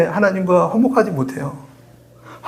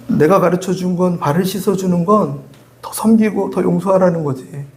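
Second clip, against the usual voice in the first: ordinary talk, nothing marked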